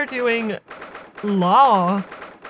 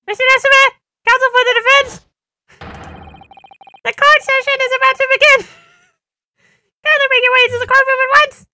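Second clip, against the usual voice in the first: high, loud courtroom bailiff; 9 dB louder, faster than usual. Acted. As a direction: announce it like a pompous bailiff. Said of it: "this stupid bailiff voice".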